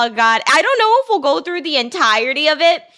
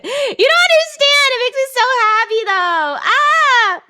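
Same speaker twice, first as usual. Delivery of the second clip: high, giddy gush; high-pitched wail of joy; overjoyed squeal; 10 semitones higher than usual. Real, not acted. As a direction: squeal with uncontainable happiness. Gushing again about the gift.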